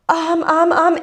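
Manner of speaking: high, flustered stammer; panicking. Retold or imitated. Put on the spot by the woman's question, mock panicked stammer. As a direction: stall in a retold panic. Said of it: "And you're panicking".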